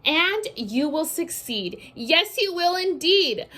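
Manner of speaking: lively picture-book read-aloud; sing-song storybook reading; reading to children. A narrator's reading.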